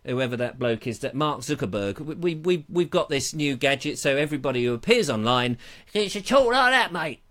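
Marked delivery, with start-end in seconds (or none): 5.9-7.2 higher, hammed-up matey British accent; mock processed British voice. Shown as a demonstration. Demonstrating joke voice gadget for online presenters, mock British accent. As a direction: put on an exaggerated British twang, pitched up. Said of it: "their voice gets put through this, uh, thing".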